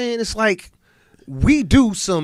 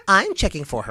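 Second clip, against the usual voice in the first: mocking radio voice; 3 semitones higher than usual. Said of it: "In her perfect radio voice".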